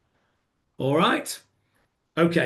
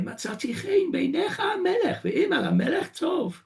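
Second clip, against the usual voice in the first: much higher, whining and pleading; whiny voice; 12 semitones higher than usual. Shown as a demonstration. Esther pleading to the king, whiny. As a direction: whine it like a pleading queen. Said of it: "in a sort of whiny voice".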